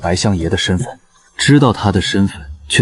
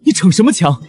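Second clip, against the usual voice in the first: higher, insistent plea; anxious; 11 semitones higher than usual. Acted. Rabbit spirit anxiously urges him to take the spirit energy. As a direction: press him urgently.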